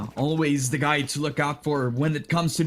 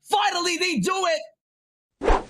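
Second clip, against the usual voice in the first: screaming hype call; 13 semitones higher than usual. Real, not acted. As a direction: scream the clutch as it happens. Clutch defuse, shouting play-by-play.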